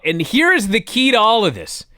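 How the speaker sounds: usual speaking voice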